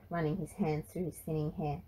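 usual speaking voice

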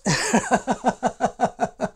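high burst of real laughter; teacher laughing at a student's breakfast joke. Real, not acted.

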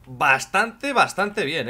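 first BASTANTE drawn out long and loud; emphatic repeat. Real, not acted. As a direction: lean hard on BASTANTE, stretching it out slowly. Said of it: "Cuando digo bastante bien, digo".

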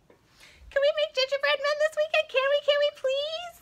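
very high squeaky pleading puppet; begging puppet. Pretend. Puppet begs the librarian to bake gingerbread men. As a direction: beg eagerly like an excited child.

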